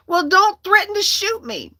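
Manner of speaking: high-pitched, re-enacted indignant comeback; heated, high-pitched rebuke; indignant retort. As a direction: throw it back at them, high and exasperated. Acted retort in the quarrel.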